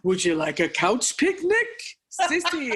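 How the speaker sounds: teasing the dog in a sing-song, rising high; high cooing voice for the bulldog; high baby voice to the dog. Retold or imitated. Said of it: "make jokes at her all the time and go".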